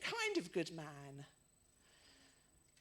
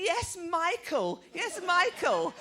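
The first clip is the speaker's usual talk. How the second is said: high, slow, louder insisting; old lady insisting to the toddler, louder; insistent old lady; 8 semitones higher, 10 dB louder, slower than usual. Retold or imitated. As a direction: talk over the child patiently.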